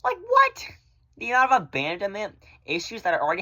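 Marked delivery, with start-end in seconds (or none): 0.0-0.7 high squeaky disbelieving what; shocked what. Retold or imitated. Vlogger recalling the shock of being left, squeaking.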